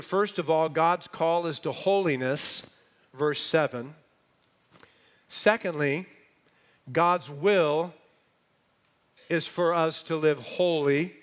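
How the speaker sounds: loud, building pulpit cadence; rising sermon climax; preaching at full force. Acted. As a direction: drive each point home to the congregation. Climactic list of affirmations.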